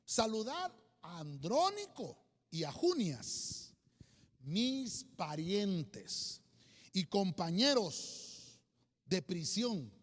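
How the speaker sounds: slow, deliberate Bible reading; measured reading with long pauses; scripture reading. Acted. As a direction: read the verse aloud solemnly, phrase by phrase. Reads scripture aloud.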